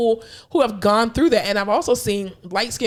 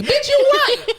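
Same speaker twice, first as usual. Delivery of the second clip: high laughing outburst; laughing retort; 3 dB louder, much slower than usual. Real, not acted. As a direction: laugh it out, much higher.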